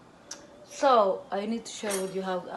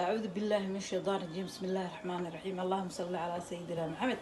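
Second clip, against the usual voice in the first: rapid, softer murmured invocation; quick prayer recitation; 6 dB quieter, much faster than usual. Acted. Cook opening her video with a prayer, rapid soft recitation. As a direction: rattle off the opening prayer quietly and fast.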